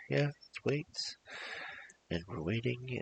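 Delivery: plain reading voice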